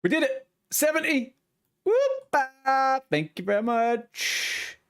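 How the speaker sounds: high, thrilled cheer with whoop; celebrating. Real, not acted. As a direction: whoop it up for the win. Level seventy reached.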